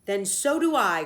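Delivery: loud, eager agreement; loyal cry. A narrator's reading. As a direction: call it out, fiercely on his side. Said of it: "cried Stewart loyally".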